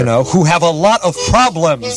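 mock-concerned, drawn-out lecture; voice of responsibility. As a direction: plead with the guys to be responsible. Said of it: "I always play the voice of responsibility".

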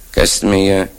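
normal talking voice

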